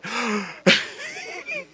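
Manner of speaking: a sharp, high gasp of shock; sudden scandalized intake of breath; imitated shocked gasp. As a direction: gasp like a stunned congregation. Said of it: "They're going".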